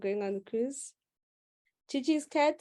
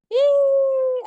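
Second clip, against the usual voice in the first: a piercing, loud squeal; high squeal; 12 dB louder than usual. Real, not acted. Teacher squealing at the class's stale brains. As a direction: squeal in exasperated amusement.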